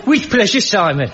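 put-on Birmingham accent. Asked by the host to do his Birmingham accent again. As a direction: give him Brummie on request. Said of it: "give us your Birmingham accent again".

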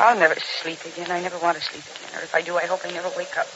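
low, soft despair. Acted. Exhausted wife to husband as court drags on, despairing. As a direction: sink into hushed hopelessness.

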